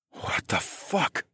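whispered, slow stunned mutter. A narrator's reading. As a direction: mutter it under your breath. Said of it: "I muttered".

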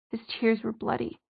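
plain reading voice

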